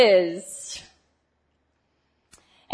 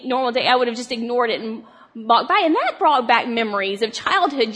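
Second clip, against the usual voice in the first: ordinary talk, nothing marked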